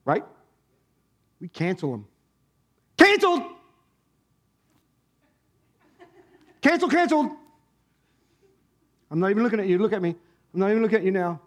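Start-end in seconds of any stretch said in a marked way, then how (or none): 3.0-7.3 high, loud burst of dismissal; mock cancelling shout. Preacher acts out churchgoers cancelling someone, high mock shout. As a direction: call it out sharply like a verdict.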